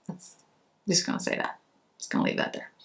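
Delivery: a soft teasing whisper; whispered aside. Real, not acted. To her viewers, whispering a teasing half-admission. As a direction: whisper it and let it hang.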